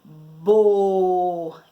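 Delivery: "bo" loud, with a long held O; sustained, emphatic "booo"; drawn-out syllable. Shown as a demonstration. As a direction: stretch "bo" firmly after a pause. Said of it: "utilizar a sílaba".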